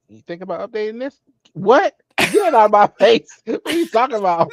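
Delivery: high, loud mock indignation; laughing through a hammed-up rant; mock outrage. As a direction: play an offended exec yelling, half laughing. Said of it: "I bet somebody was like".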